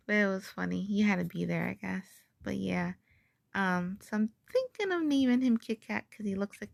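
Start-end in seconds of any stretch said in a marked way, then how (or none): none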